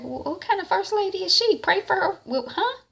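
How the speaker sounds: very high, sassy incredulous voice; incredulous rant. Real, not acted. Viewer reacting to Carissa asking why pray, high sassy. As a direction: call her out, dripping disbelief. Speaker's own mock reaction.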